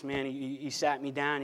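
ordinary delivery

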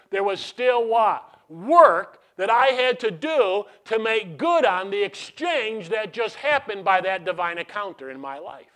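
loud, rising preacher's voice, building; preaching at full force. Acted. Preacher to congregation on divine encounters, loud and building. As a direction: preach it out to the back row.

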